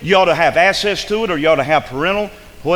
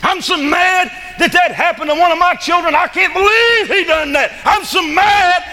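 loud, high yell of hammed-up rage; furious mock rant; 6 dB louder than usual. Preacher acting out Jacob's wrath, hammed-up furious yelling. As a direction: explode as an outraged father, pitch way up. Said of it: "he got mad".

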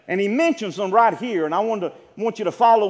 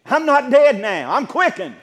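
ordinary talk

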